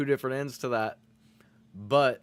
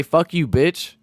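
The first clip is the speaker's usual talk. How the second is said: loud mock insult; 4 semitones higher, 12 dB louder, slower than usual. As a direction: shout it, loud and slow. Acting insult toward friend.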